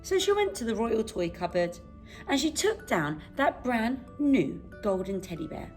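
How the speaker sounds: ordinary delivery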